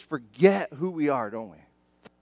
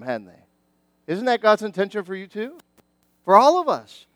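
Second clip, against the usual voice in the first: ordinary delivery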